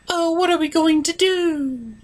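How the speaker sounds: high, fretful pony voice; high Fluttershy voice. Acted. Fluttershy fretting over the uncracked code, high and timid. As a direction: wring your hooves in a high little voice. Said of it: "are we seriously going with... Fluttershy's voice".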